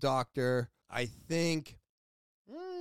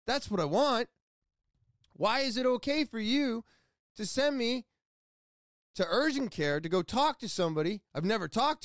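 high-pitched indignant demand; retold protest, insistent and worked up; upset; 7 semitones higher than usual. Patient refusing urgent care and demanding their own doctor, upset. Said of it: "made me extremely upset".

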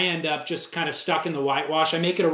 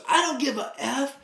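higher hammed-up mock yell; retelling the other surfer's outburst, mock yelling; imitating a shout; 8 semitones higher than usual. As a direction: fling it back like a touchy stranger. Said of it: "and just shouts".

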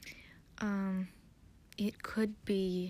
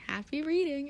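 chirpy Miss Minutes; 6 semitones higher, 5 dB louder than usual. Retold or imitated. Miss Minutes voiced, cheeky exit.

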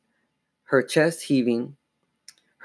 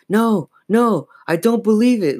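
high-pitched cry of shock; shrieked disbelief; 10 semitones higher, 4 dB louder than usual. A narrator's reading. Sister seeing the dummy move, shrieking. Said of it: "Lindsay shrieked".

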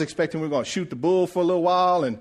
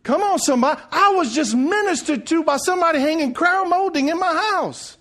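high, impassioned sermon climax; full-force preaching; 11 semitones higher, 4 dB louder than usual. Acted. Preacher telling church he was ministered to, full-force preaching. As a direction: build it up and proclaim it.

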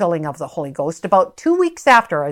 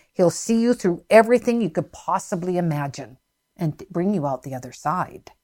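usual speaking voice

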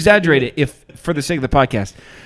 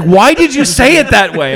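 imitating appalled questioning; 10 semitones higher, 6 dB louder than usual. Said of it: "She's like".

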